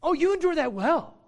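loud, high mock praise; ironic sarcasm. Preacher as Paul mocking their endurance, sarcastic. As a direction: exclaim it as biting false admiration. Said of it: "ironic sarcasm".

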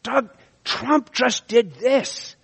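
outraged gossip. Retold or imitated. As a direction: sell the shock of the news. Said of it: "And they tell you".

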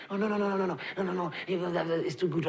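low, disbelieving mutter of refusal; doubting inner voice. Retold or imitated. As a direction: brush it off as too good to be true. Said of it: "We think".

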